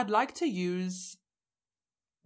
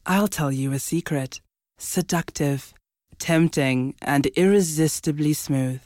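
seductive chocolate ad voiceover; 2 semitones lower, 8 dB louder, slower than usual. Shown as a demonstration. Demoing her chocolate ad voiceover for the cohost, sultry and slow. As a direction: purr it like a luxury chocolate commercial. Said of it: "Listen to this".

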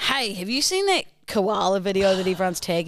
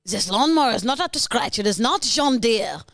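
unhappy German groundskeeper; indignant mock-German complaint; German accent. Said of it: "You're a German groundskeeper, unhappy".

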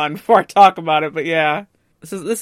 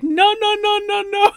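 much higher, rapid protesting nos; mock panic; 13 semitones higher than usual. Hero protesting as the bride picks Ando, mock panic. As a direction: squeal the nos in mock alarm. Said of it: "And Hero's like".

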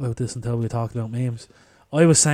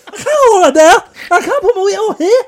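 panicked Scottish bloke yelling from a roof, very high and loud; Scottish accent; 16 dB louder than usual. Retold or imitated. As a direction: shriek it in a frantic Scottish impression. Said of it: "the Scottish bloke up on the".

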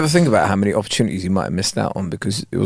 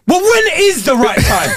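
high, drawn-out melodramatic demand; soap opera outburst; slower than usual. Acted. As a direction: overact a slow, high-pitched confrontation. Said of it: "soap opera way".

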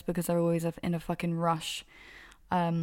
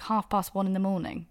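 normal talking voice

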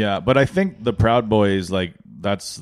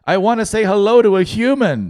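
high, loud, shaky plea; a lonely old person begging to talk; lonely senior; 11 semitones higher, 6 dB louder than usual. Retold or imitated. Lonely senior answering the phone, high and pleading. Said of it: "their hand is shaking like".

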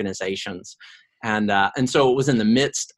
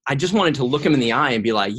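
ordinary talk

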